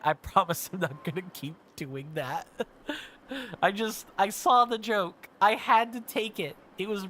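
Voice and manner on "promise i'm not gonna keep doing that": ordinary delivery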